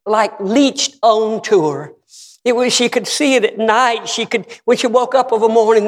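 high, insistent cadence building to a shout; fervent preaching. Real, not acted. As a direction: build the burden up, then cry out the release.

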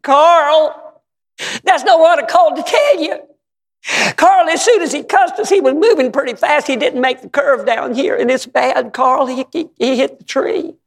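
rushing the bad news out in alarm; distressed wife. Wife telling Carl about the crash, high upset voice.

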